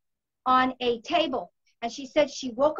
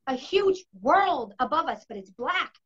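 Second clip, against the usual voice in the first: ordinary delivery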